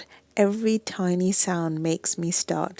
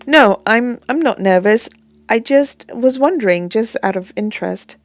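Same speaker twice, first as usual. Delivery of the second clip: defensive loud; 3 semitones higher, 9 dB louder than usual. Acted. Denying being a nervous flyer.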